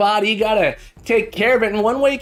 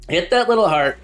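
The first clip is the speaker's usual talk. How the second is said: ordinary talk, nothing marked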